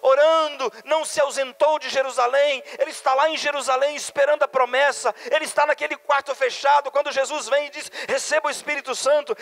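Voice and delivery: high, loud rapid-fire preaching cadence; pregação inflamada. Real, not acted. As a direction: preach at full force, driving phrase after phrase. Preaches at full force. (pregação fervorosa, voz alta e aguda)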